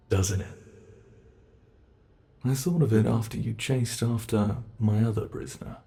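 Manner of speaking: ordinary talk, nothing marked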